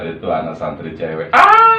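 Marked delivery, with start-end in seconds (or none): 1.3-1.8 mock squeal. Acted girl's cry.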